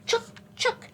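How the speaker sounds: high, sharp squirrel chucks; squirrel chatter. A narrator's reading. Teacher showing children what mama squirrel says, high squirrel chatter. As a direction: squeak it out in quick separate bursts. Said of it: "See, she's saying".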